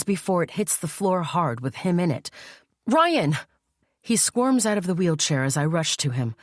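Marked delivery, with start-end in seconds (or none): 2.9-3.4 alarmed cry. Acted. Sister cries out as brother falls.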